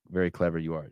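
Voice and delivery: quiet hint of Yoda, wry and amused; Yoda-style line. Quietly echoing a clever line, a light Yoda imitation. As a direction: murmur it like a lightly voiced Yoda. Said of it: "Yoda talks like that".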